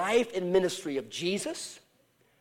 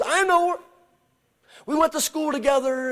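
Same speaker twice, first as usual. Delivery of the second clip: high, loud voice from across a room; calling from the back; 9 semitones higher, 8 dB louder than usual. Retold or imitated. Woman at back of the room answers the preacher, high loud call. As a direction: project it eagerly across the hall.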